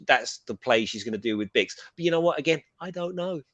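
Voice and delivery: ordinary talk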